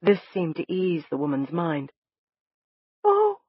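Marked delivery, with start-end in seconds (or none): 3.0-3.4 high, startled gasp; sharp high cry of alarm. A narrator's reading. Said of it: "Sonny gasped behind me".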